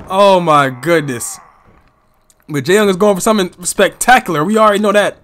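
excited live play-by-play calling; wrestling commentator. Acted. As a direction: call each move as it lands.

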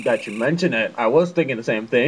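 low Goofy voice. Pretend. Friend reading Goofy's line in a low dopey voice. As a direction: drop way down for a goofy sidekick. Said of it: "That's exactly how Goofy sounds".

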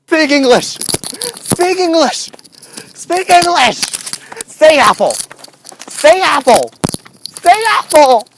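loud, insistent, punchy order; shouted command. Real, not acted. Ordering ducks to speak.

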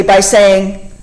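ordinary talk

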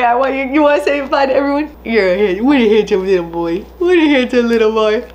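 gushing to her pet Dante; pet talk. Real, not acted. Talking to her dog Dante.

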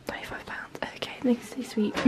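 hushed whisper among the shelves; shop whisper. Real, not acted. Browsing a quiet homeware shop, hushed whisper.

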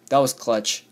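ordinary talk

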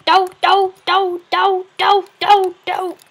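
high loud chanted doo doo sounds; boisterous mock monster drone; imitated monster noise. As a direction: mimic a spooky game creature droning do do do. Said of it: "Harry Muller going".

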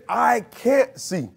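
drawn-out I can't see; mock the doubter, slowly; doubtful quote, slow. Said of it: "You can't say".

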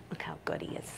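hushed admiring whisper. Real, not acted. Groomer whispering praise of the calm dog, whisper.

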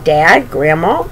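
ordinary delivery, nothing marked

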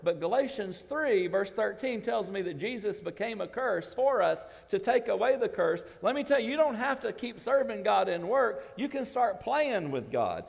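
rising pulpit preaching; preaching at full force. Acted. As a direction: drive the scripture home. Preacher building again.

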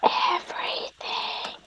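slow, eerie whisper of "Everything."; creepy whisper. Pretend. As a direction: breathe "Everything." slowly like a stalker. Creepy watching teammate.

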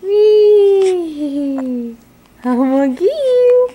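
put-on high, loud playful squeal at the baby; playful baby talk. Mother playing with baby.

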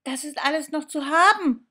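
high, loud and fast eager call; thrilled doll calling into the phone; excited calling. Acted. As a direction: blurt it out before he hangs up. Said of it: "ruft die Puppe Annabelle".

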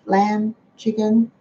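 normal talking voice

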